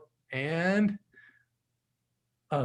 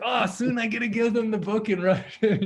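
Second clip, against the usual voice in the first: higher, retelling her own burst of excitement; a gushing, delighted inner voice, pitched up; excited; 7 semitones higher than usual. Retold or imitated. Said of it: "I was so excited that I'm like".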